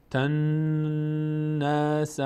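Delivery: very slow chanted "تنس", n sustained; ghunnah. Shown as a demonstration. Teacher naming the held noon, drawn-out nasal hum. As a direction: hold the nasal n long in "تنس". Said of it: "این نگه داشتن صدا".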